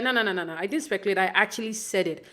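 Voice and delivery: normal talking voice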